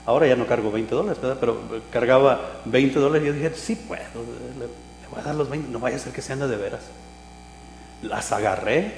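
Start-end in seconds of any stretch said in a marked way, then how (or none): 3.5-6.8 private reasoning. Retold or imitated. Retells his private reasoning before paying.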